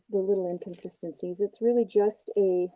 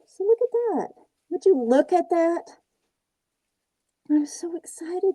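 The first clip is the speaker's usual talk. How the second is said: much higher, excited ninja dude voice; Donnie voice; 9 semitones higher than usual. Acted. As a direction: play the giddy turtle puppet, pitched way up. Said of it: "Donnie talks like this".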